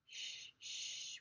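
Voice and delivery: hushed, drawn shushing; whisper. Acted. Pippi hushes Anika near the sleeping bull, whispering. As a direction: shush softly so nobody wakes. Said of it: "whispered Pippi".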